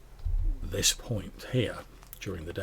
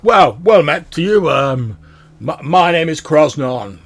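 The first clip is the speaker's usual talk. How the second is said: hearty dwarf; 6 semitones higher, 13 dB louder than usual. Acted. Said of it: "he jumps down from it and he's like".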